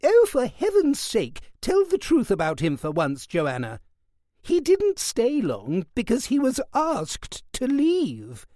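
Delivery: an outraged aunt lets rip; indignant old aunt. Acted.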